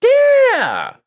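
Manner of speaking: high, loud, gleeful egging-on; excited hype. Real, not acted. Host egging a creator to launch his book, excited and loud. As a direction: cheer him on to launch it now.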